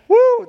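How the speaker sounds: high whoop with a laugh; laugh, then let out a high "woo"; delighted, high laughing whoop. Real, not acted. Preacher still whooping with laughter.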